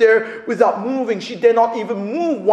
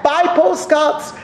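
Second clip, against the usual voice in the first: higher, slow, insistent broken-English plea; pleading street vendor; 9 semitones higher than usual. Retold or imitated. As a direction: beg like a pushy old vendor, slowly and high. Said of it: "waving her hand".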